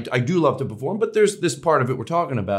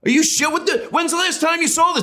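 much higher, indignant what the fuck; outraged disbelief; 13 semitones higher than usual. Retold or imitated. Comic behind the curtain seeing someone on their phone, outraged. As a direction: rant in high-pitched outrage at the phone-looker. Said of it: "I'm like".

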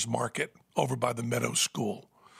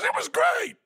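very high, loud delighted cry; giddy Lou; 4 dB louder than usual. Retold or imitated. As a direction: echo it giddily. Lou laughing hysterically.

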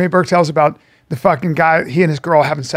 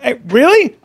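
higher, louder disbelief; incredulous voiced lion; 7 semitones higher, 5 dB louder, slower than usual. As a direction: challenge it, can't believe the nerve. Lion reacts to pestering dog.

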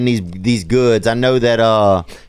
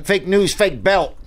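lightly mimicked catchphrase, pitched up; raised voice tossing out a borrowed catchphrase; Michael Rapaport; 6 semitones higher, slower than usual. Host mimics a celebrity's catchphrase to rib his co-host, lightly put-on voice. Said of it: "That's that Michael Rappaport, huh".

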